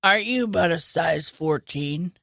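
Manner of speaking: low, creepy, sizing someone up; lightly voiced creepy killer; creepy Buffalo Bill voice. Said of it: "this is how he talks".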